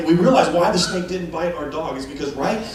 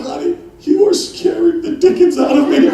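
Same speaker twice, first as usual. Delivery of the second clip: mock frightened; 12 semitones higher, 7 dB louder than usual. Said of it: "And I said".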